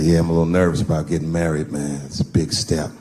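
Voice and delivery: low-pitched acted buddy voice; imitated friend, low and understated; nervous friend. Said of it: "he said".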